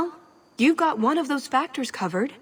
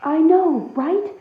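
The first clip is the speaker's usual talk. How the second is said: eager agreement; 5 semitones higher, 5 dB louder, slower than usual. Acted. Agreeing eagerly about servants.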